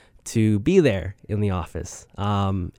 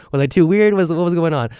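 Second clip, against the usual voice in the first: flustered; 8 semitones higher, 5 dB louder than usual. Real, not acted. Embarrassed self-questioning.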